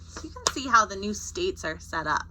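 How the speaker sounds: ordinary delivery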